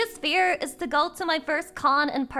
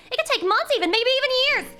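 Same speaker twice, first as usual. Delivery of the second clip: rising excitement; 11 semitones higher, 7 dB louder, much faster than usual. Real, not acted. Streamer escalating mock despair.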